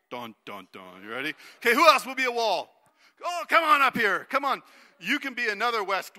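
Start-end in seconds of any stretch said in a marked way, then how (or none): 0.1-1.0 ominous dun dun dun. Shown as a demonstration. Teacher showing kids the Jericho sound, low ominous sting. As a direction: growl it like a movie villain's theme. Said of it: "when we say Jericho, we say".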